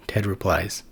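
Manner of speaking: usual speaking voice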